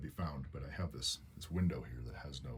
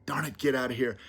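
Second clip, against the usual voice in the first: raised, high exasperated voice; upset yelling; 10 semitones higher, 7 dB louder than usual. Retold or imitated. Owner scolding Squiggy for coming back again, upset yell. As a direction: scold the pet loudly and send it off. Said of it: "I was so upset".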